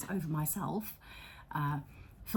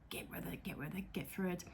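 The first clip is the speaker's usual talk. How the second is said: soft, urgent whisper to herself; whispered self-pep talk; 9 dB quieter, faster than usual. Retold or imitated. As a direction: quietly push yourself through.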